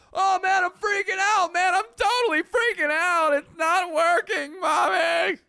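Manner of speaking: cartoonish fake crying for mommy; mock panic. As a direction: wail like a scared kid calling mommy. Mock panic over crashing software.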